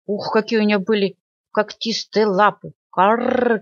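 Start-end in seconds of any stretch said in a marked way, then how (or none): 2.9-3.6 crow caw. Acted. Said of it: "кричала ворона". (карканье вороны)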